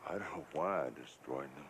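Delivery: low, soft and sorrowful; quiet regretful apology; heartbroken. Acted. Apologizing for the destroyed letters.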